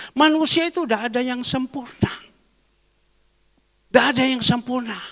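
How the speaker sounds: loud, high preaching; emphatic sermon voice; preaching at full force. Acted. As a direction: drive the point home from the pulpit. Preaching climax.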